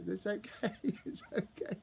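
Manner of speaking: high, tearful laugh while comforting; real emotion breaking into laughter; laughing through tears. Real, not acted. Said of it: "and then I started as well".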